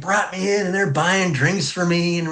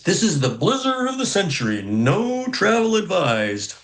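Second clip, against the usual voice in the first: radio announcer; 5 semitones higher than usual. Retold or imitated. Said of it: "it says".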